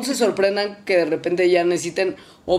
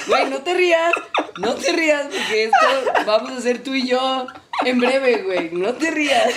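laughing hard; 6 semitones higher than usual. Real, not acted. Cracking up over the joke.

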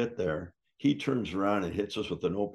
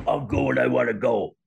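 defiant snap; 4 semitones higher, 7 dB louder than usual. Retold or imitated. Jim brushing off the question.

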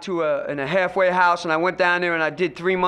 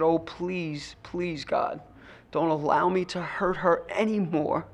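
much softer, low desperate plea to God; hushed prayer; 4 semitones lower, 7 dB quieter, slower than usual. Retold or imitated. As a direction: pray under your breath, begging. Desperate prayer, eyes closed.

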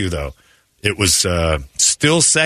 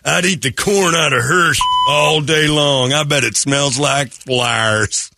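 raised, drawn-out imitation of a leering guy; lewd golfer; 6 semitones higher than usual. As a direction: play the guy crowing lustfully, stretching it out. Mimicking the lewd golfing partner.

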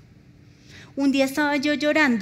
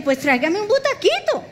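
higher, playful comeback; cheeky retort; 8 semitones higher than usual. Retold or imitated. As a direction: toss it off as a joke. Joking retort to nurse.

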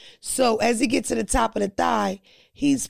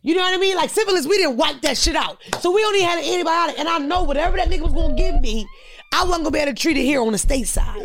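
higher, louder and fast; excited rant; 7 semitones higher, 5 dB louder, faster than usual. Real, not acted. Comedian explaining to friends why she held back, fast and excited. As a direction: rush it out, laughing at yourself.

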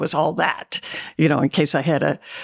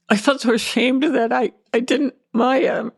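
emotional; 7 semitones higher than usual. Real, not acted. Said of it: "it's very emotional for me".

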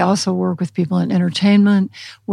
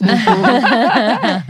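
rolling, higher-pitched laugh; amused laugh; 4 semitones higher, 3 dB louder than usual. Real, not acted. Trainer after voicing the dog getting keys, laughing. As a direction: crack up at your own joke.

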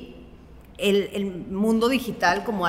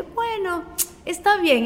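booker agreeing; 10 semitones higher, much slower than usual. Retold or imitated. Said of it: "Y él me dijo como que".

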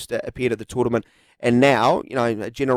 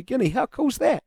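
excited; 10 semitones higher than usual. Real, not acted. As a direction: pitch jumps up high on the question. Host excited about Papua New Guinea.

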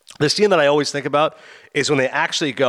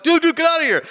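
high-pitched urgent pleading; mock urgent plea; 13 semitones higher, 3 dB louder than usual. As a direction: imitate a friend desperate to leave. Acts out panicked friend during robbery.

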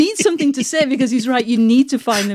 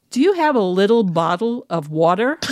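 careful American accent demo; coached American accent. Shown as a demonstration. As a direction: try out a rehearsed American accent. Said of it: "teach me an American accent".

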